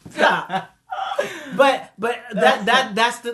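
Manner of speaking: giggly high voice through laughter; can't stop laughing while talking. Real, not acted. Laughing after the love exchange.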